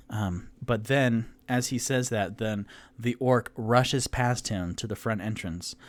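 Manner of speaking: normal talking voice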